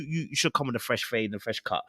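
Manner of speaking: ordinary talk, nothing marked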